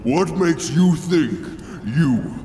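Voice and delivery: slow, booming villain voice; menacing dark lord, slow and grand. Acted. Villain speech in ad sketch.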